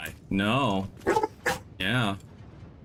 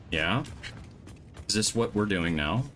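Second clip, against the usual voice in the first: ordinary talk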